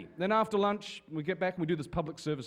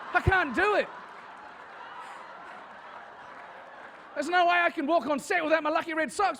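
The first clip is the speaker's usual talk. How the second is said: much higher, laughing whine about lost socks; whiny Karl impression; 10 semitones higher than usual. Vigo pranks the agent on the phone, high laughing whine. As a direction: beg like a fussy superstitious actor. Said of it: "Vigo had called Jenny up and said".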